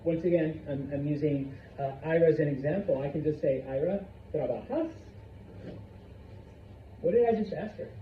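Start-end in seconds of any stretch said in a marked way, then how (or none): 3.7-4.9 "trabajas?" rising on its last syllable; one-word question. Shown as a demonstration. Teacher asking Ira a one-word question, rising tune. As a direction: lift the end of "trabajas" to ask. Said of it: "you would raise the pitch".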